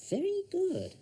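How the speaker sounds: very high sing-song well done; praise to a child. Acted. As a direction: cheer the boy on.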